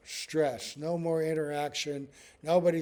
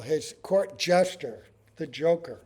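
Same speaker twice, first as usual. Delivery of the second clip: ordinary talk, nothing marked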